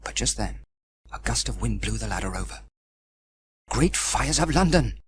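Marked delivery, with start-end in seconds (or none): 3.7-5.0 mild cry. Acted. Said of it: "cried Sam".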